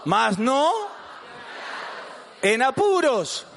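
high, chant-like call in short phrases; forceful group reading. Real, not acted. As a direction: lead the congregation, each phrase punched out. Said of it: "lo leemos con fuerza, con gana". (lectura con fuerza, para que todos repitan)

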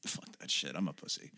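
whispered, dismissive; whispered shrug-off; whispered aside. Real, not acted. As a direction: drop to a breathy whisper. Muttered aside after self-quote.

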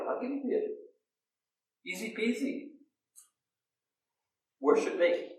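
high breathy devil whisper, worship me; tempter whispering. Acted. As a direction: breathe the offer like a sly devil. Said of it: "that same voice says".

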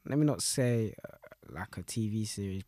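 ordinary talk

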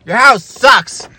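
hammed-up angry gamer kid; mock kid yell. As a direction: kid shouting at his friend over the game, slowly. Kids yelling at game, acted.

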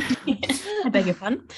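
prim English politeness hiding offence; posh English affront. Shown as a demonstration. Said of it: "Say that again".

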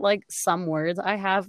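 ordinary delivery

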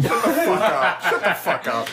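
amused mock outrage; laughing protest. Real, not acted. Host answering the teasing comparison, laughing protest. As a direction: fire back while cracking up.

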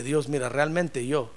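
low, softened mock prayer; acted admission, dropping low and soft; humble confession. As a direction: confess it quietly to God. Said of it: "mejor decirle".

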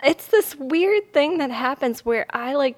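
ordinary delivery

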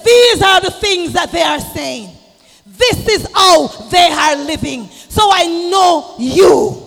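loud, high shouted sermon; impassioned preacher's shout; fiery preaching. Acted. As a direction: build the congregation up with a shout.

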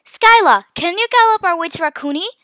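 high, eager polite question; polite request. A narrator's reading. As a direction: call the name, then ask politely. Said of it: "I asked politely".

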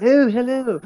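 a mock posh British accent, pitched high; a plummy British greeting, done for a laugh. Mocking how American actors do a posh British accent, high and prim. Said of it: "royalty or common".

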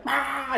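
sudden high squawking "Ah!"; mock parrot screech at passersby; parrot yell. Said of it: "He would, like, yell".

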